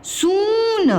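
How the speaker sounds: सून with a long, drawn-out oo; mistaken long vowel. Shown as a demonstration. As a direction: stretch the oo of सून, gliding down at the end. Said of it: "आप क्या कर देते हो mistake".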